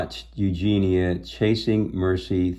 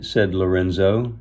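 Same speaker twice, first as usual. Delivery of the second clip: ordinary delivery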